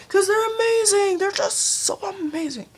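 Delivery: high fangirl gushing; high-pitched adoring outburst. Real, not acted. Gushing over adored singers.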